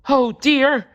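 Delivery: frightened yelp. A narrator's reading. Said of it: "yelped Mole".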